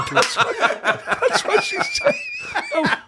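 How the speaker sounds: squeaky, high laughing words; laughing hard at the punchline. Real, not acted. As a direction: crack up on the line. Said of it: "laughing hard".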